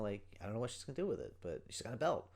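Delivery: ordinary delivery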